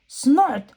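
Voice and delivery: high squealy snorts; pig snorts. Acted. George snorting that he does not know. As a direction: snort back like a piglet.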